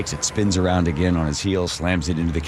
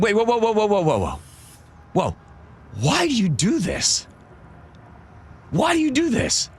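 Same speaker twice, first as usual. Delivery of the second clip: baffled protest; 13 semitones higher than usual. Retold or imitated. Driver finally asking toll collector his reason, baffled protest. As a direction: pitch it high, demanding an explanation.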